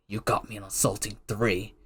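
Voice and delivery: low, hushed superhero impression; Batman. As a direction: drop it low and quiet, like a brooding vigilante. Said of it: "Batman's like".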